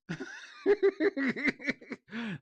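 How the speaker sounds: big high cackle; loud high laugh. Real, not acted.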